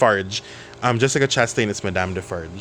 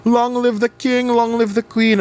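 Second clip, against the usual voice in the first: mock screaming; 11 semitones higher than usual. Mender of roads screaming for king and queen, hammed-up high cheer. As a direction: scream it like a starstruck peasant. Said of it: "was screaming".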